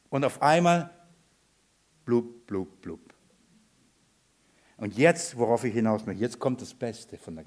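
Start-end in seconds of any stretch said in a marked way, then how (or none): 2.0-3.0 soft, low "blub" noises, spaced out; imitated sinking bubbles. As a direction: imitate quietly going under. Macht das Versinken nach. (leises, tiefes Blubbern nachgemacht)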